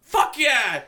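high, loud rallying shout; imitated holler. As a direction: holler it to the whole group. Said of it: "we kept hollering out".

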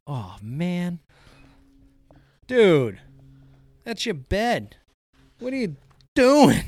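high, loud exasperated scolding of the dog. Real, not acted. Owner to dog chewing up her bed, exasperated loud scolding. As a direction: scold her like she just wrecked everything.

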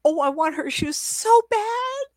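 very high, slow, whining dog; drawn-out squealed doggy longing; dog's desperate craving. Acted. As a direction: wail it like a yearning pup. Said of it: "it was the way the dog said it to me".

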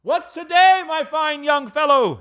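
high, hearty holler, stretched out; calling down. Acted. Scrooge calling down to a boy, high hearty holler. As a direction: hail someone below cheerfully. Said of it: "calling downward to a boy".

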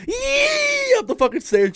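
high, loud yell, drawn out; yelling in panic. Retold or imitated. Man fleeing the spider up the stairs, loud high yell.

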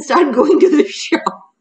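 breaking into laughter mid-sentence; amused, giggle creeping into the words; laughing. Real, not acted. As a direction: tell it through a rising laugh. Amused retelling of the show's plot.

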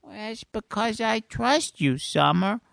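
high, slow cartoon voice; sincere squeaky character; Mister Poopy Butthole. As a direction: confide it slowly in a high silly voice. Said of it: "Mister Poopy Butthole".

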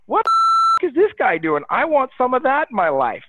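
squeaky high mock shock, then eager; imitating a caller's delighted disbelief; amazed reaction. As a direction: play the stunned listener who wants it too. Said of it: "they're gonna be like".

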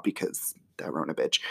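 unvoiced whispered aside; whispering. Real, not acted. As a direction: drop to a whisper for the joke. Aside under her breath.

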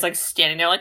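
usual speaking voice

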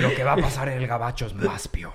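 fast, breathy Spanish whisper; mock whisper. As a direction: whisper it like a scheming narco. Narcos whispered quote.

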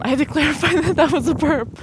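high, loud, laughing clarification; amused. Real, not acted. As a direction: giggle while clarifying, pitch lifted. Giggling over own burp.

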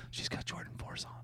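hushed gossipy whisper; a secretive whispered aside. Retold or imitated. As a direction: whisper it so no one hears. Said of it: "She goes".

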